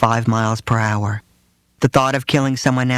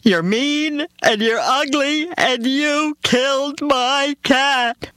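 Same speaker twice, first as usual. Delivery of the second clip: put-on very high, slow sobbing little girl; little girl sobbing insults at the driver; wailing child; 13 semitones higher, much slower than usual. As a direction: whine it like a wronged child. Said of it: "she screamed".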